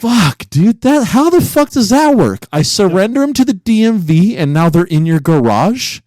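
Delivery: high, loud, incredulous outburst; can't believe what he's hearing; stunned disbelief. Real, not acted. Host reacting in disbelief to cohost having his plate.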